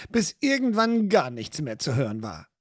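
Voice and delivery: ordinary delivery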